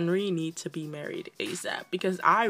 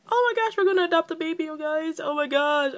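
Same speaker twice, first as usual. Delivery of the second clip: high-pitched rushed excited gush; real excited freak-out; 9 semitones higher, 3 dB louder than usual. Real, not acted. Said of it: "I am still freaking out".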